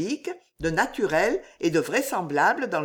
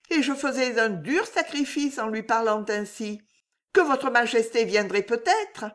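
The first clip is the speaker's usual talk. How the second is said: put-on a lightly higher young woman pleading; a hint of a young woman in love; Juliette's voice; 7 semitones higher than usual.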